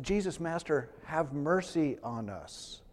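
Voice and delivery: quiet humble asking for mercy; soft plea. Acted. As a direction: say it gently, almost to yourself. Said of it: "They said".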